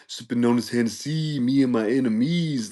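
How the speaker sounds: lightly mock the rapper's delivery. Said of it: "how [name] used to be like, he talked like".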